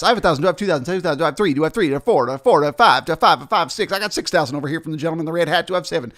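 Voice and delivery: fast, rolling auctioneer chant; rapid auctioneer patter. Retold or imitated. Auctioneer rushing early bids, fast patter. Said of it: "he's gonna be going very fast".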